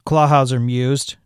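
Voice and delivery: plain reading voice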